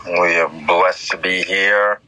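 low, solemn preacher voice; preaching. Acted. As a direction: lay it down like a sermon.

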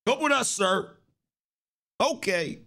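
normal talking voice

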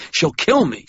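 high, loud frightened cry; panicked fear. Acted. Carney fearing his boss, high panicked cry. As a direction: plead for your life.

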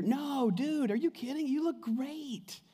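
high, breezy fake-friendly reassurance; butter them up like a hollow church friend; mock flatterer. Laodicean churchgoer flattering a seeker, high and breezy. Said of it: "here's what you'd hear".

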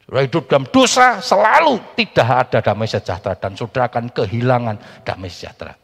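loud, high sermon delivery; preaching at full force. Acted. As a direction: proclaim it to the congregation.

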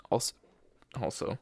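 interrupted by hiccups; words broken by a hiccup. Real, not acted. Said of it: "I have the hiccups".

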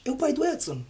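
high, chirpy school staff; school worker voice. Retold or imitated. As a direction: mimic a cheerful school attendant. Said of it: "a contínua diz".

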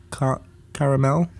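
"caramel" as three syllables with an "air" vowel, unsure; trying out pronunciations. Shown as a demonstration. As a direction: stumble on ca-, then say caramel with a full -mel. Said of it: "I don't know how to say it".

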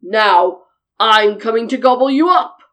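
loud, low troll threat; big menacing troll. A narrator's reading. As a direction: boom it like a hungry troll. Troll threatens the second goat.

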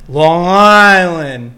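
put-on loud, long-stretched Long Island accent; hammed-up Long Island accent. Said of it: "That was really good".

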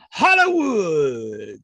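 slow, drawn-out announcer call; mock ring announcer bellowing the name. Announcer echo of wrestler name.

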